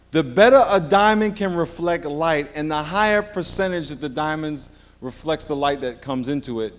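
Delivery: ordinary talk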